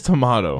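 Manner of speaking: tomato as tuh-MAH-toh; British pronunciation. Shown as a demonstration. Co-host doubts anyone says it, tomato with MAH. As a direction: say tomato with a broad AH. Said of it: "who says tomato?".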